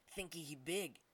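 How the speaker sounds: broken-English ape voice, taunting; a bully ape jeering at a lizard; big ape. Acted. Big ape mocking the helpless dragon, in a taunting ape voice. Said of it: "one of the larger apes said".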